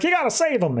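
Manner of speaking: high, urgent plea through the glass; desperate high cry; pleading shout. Retold or imitated. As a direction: beg them to save him. Flash banging on glass, pleading.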